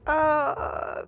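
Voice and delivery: high, drawn-out uneasy "uh"; retold nervous hesitation. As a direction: imitate kids having second thoughts. All of the kids are, like, freaking out.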